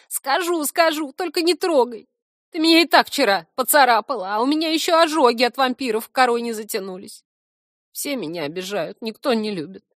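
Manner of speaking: high, put-on whiny creature voice; loud whining, sinking into self-pity; pleading complaint. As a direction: beg to be left alone, then wallow in grievance. Cornered stump pleads and complains.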